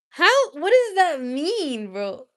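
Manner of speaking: high incredulous how; shocked at the chat, drawn out slowly; stunned question to chat; surprised. Real, not acted. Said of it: "reacting to chat comment".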